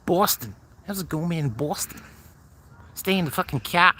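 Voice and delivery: high-pitched put-on Boston accent. Streamer playing a local greeting a man, mock Boston accent. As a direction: imitate a Bostonian, pitched well up. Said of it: "Love the accent".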